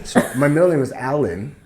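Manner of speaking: normal talking voice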